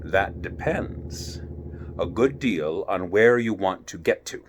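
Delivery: low, slow, half-whispered cat voice; a sly grinning cat drawing out his words; Cheshire Cat. Acted.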